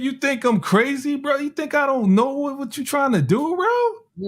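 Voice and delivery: high, heated street comeback; mock indignant retort. The speaker snaps back at the producer, high and heated.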